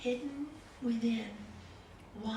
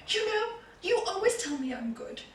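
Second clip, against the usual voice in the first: imitating an upset grandchild, pitch climbing; mock tearful protest; 6 semitones higher, 8 dB louder than usual. Crying granddaughter protests to her granny, mock tearful and rising. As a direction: plead as the crying child, building louder and higher. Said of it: "And she starts crying".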